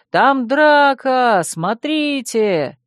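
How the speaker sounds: high, loud, slow shouted alarm; shouting. A narrator's reading. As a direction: yell it out for the crowd. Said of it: "закричал кто-то".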